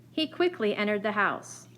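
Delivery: usual speaking voice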